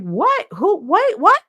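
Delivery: high, loud startled questions; retold panicked bewilderment; alarmed confusion. Reacting to hysterical phone call.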